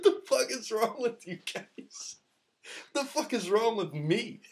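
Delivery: high, laughing incredulous question; laughing disbelief. Retold or imitated. Asking his friends in disbelief, high and laughing. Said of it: "I was like".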